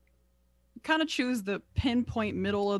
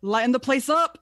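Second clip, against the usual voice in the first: raised, louder casting call; commanding in-character call; spell incantation; 4 semitones higher, 7 dB louder than usual. Acted. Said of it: "as she says".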